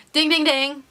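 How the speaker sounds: loud, high ding-ding-ding; mock bell dings. Flagging her own slip to viewers, mock bell. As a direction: ring out like a game-show bell.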